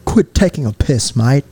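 mock Australian-sounding British; British slipping into Australian. Shown as a demonstration. Host repeating for friend, British accent gone Australian. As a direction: aim for British, land on Australian. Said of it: "that was Australian".

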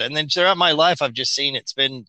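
ordinary talk

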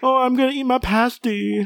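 high mocking childish British voice; mock British baby talk. Host mocking British slang in a high babyish voice. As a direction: sneer it like a whiny Brit toddler.

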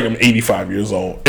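low, soft mock confession; confiding admission. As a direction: drop the voice to share a secret. Acted reluctant confession of age.

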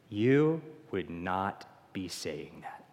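slow, low and hushed, almost to herself; a quiet admission of the real self; hushed confession. Acted. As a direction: let it drop to a slow murmur.

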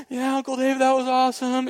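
put-on high, breathless little boy gushing; excited kid voice. Rescued boy gushes to his uncle in a high kid voice. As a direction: sound like a thrilled seven-year-old.

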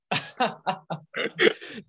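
very high giddy squeal of a laugh. Real, not acted. Laughing at the James Earl Jones story, squealing laugh. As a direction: let a shrill laugh burst out.

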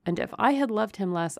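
ordinary delivery, nothing marked

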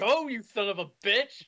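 loud frustrated yell; shouting. Real, not acted. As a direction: shout it out in exasperation. Yelling at the start countdown.

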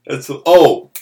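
loud, high talk through laughter; can barely get the words out for laughing; real laughter. Real, not acted. Cracking up at joke.

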